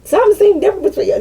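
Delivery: high, fast mock-curious voice; nosy people. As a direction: squeak it like a prying friend. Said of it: "people be like".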